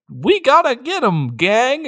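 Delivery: high, hammed-up rallying call; mock sheriff rallying. Sheriff rallying his deputies, hammed-up and high. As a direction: pump up the posse in a silly high voice.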